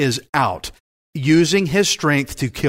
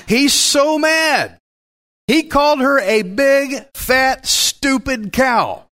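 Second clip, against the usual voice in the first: mock angry shout; 9 semitones higher, slower than usual. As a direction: voice jumps way up, slow, outraged. Preacher voicing Samson's anger.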